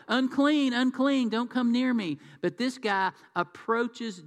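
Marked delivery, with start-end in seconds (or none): 0.1-2.2 loud, high cry of unclean; leper's warning yell. Retold or imitated. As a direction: call out a warning to keep people away. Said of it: "you were supposed to yell".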